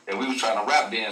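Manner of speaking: usual speaking voice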